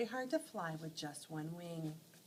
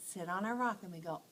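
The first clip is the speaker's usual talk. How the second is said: ordinary delivery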